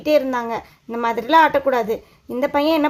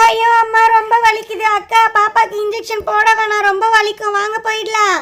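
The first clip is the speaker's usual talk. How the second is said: high-pitched, hammed-up bawling; loud wailing; 8 semitones higher, 5 dB louder than usual. Child begs to spare younger sibling the injection, wailing. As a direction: wail it out high, begging to leave. இப்படி கத்திட்டு இருக்க.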